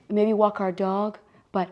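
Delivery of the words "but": usual speaking voice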